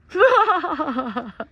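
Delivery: high loud burst of laughter; giddy laugh; delighted laugh. Real, not acted. Delighted at condor.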